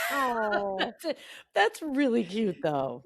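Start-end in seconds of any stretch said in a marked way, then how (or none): 0.1-0.8 real delighted laughing oh; laughing aww. Real, not acted. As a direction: burst out laughing warmly. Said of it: "reaction to entry".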